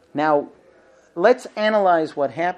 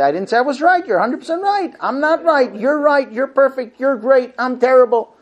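raised, louder, hurt sarcasm; mock sarcastic self-blame; 7 semitones higher, 4 dB louder than usual. Wife mocking her husband, loud hurt sarcasm. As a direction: overdo the guilt to make him feel bad.

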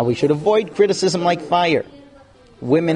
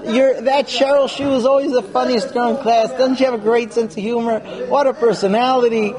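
gushing husband; 8 semitones higher than usual. Pretend. Husband praising another woman.

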